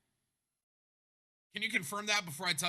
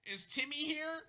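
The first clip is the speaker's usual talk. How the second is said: put-on high, lilting call for a child; bus driver calling; 12 semitones higher, slower than usual. Bus driver looking for lost Timmy, high questioning call.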